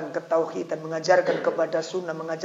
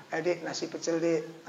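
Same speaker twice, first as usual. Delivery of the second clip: soft coaxing invite to the wife; coaxing husband; 7 dB quieter than usual. Pretend. Husband softly inviting his wife out for nasi pecel. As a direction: sweet-talk her gently into going out.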